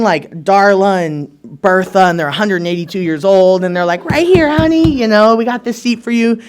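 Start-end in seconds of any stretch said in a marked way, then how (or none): 4.0-6.4 put-on higher, sugary old-lady voice; sweet old lady. Old ladies calling the newcomer to the front seat, sweet and higher. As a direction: coo it like a doting grandma.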